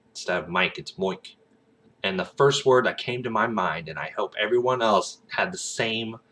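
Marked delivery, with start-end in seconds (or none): 0.9-1.4 "moik" with oi as in oil; Aussie oi vowel. Shown as a demonstration. Showing an Aussie accent tip, "moik" with oi vowel. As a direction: say "moik", the I turned to oi. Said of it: "like oi from oil".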